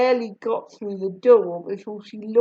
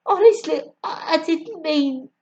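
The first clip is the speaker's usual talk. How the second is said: Buddy; 7 semitones higher than usual. A narrator's reading. Buddy protesting, embarrassed.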